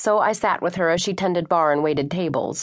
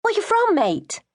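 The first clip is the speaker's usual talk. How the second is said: put-on Australian-London accent; 13 semitones higher than usual. A narrator's reading. Sophie asks where she's from.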